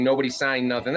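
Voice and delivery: usual speaking voice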